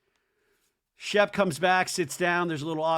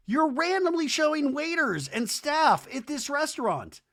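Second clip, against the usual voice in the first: high, incredulous scolding; hammed-up appalled rebuke; exasperated disbelief; 10 semitones higher than usual. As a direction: pitch it up in mock outrage. Host keeps scolding Shep.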